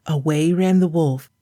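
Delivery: ordinary delivery, nothing marked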